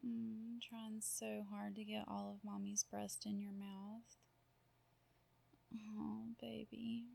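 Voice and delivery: usual speaking voice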